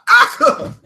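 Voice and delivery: aca as a high yelp, as if hit by a bullet; shot yelp. Shown as a demonstration. Joking about the bill's name, aca yelped as if shot. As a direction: yelp aca like you just got shot. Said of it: "something you say when you get shot".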